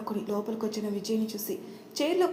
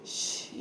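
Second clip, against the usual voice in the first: soft unvoiced shh to quiet her; whispered shush; 8 dB quieter than usual. A narrator's reading. Husband hushing his sobbing wife, whispered shh. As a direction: hush her with a breathy shh.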